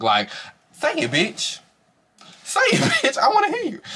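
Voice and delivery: high-pitched goading, breaking into laughter; laughing taunt. Acted. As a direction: goad them while cracking up. Acting out her taunt, cracking up.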